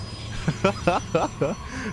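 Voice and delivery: long, high giggle; laughing. Real, not acted. Co-caster teases about time zones, high laughter. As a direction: burst into a sustained high laugh.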